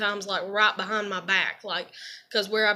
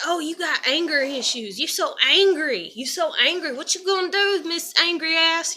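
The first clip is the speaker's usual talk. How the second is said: put-on taunting kids; 6 semitones higher than usual. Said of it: "used to when I was a kid".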